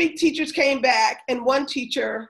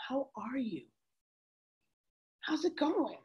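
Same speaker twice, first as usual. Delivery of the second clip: much softer, whispered greeting; 14 dB quieter than usual. Retold or imitated. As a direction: whisper it gently to the student. Teacher greets girl again, gently.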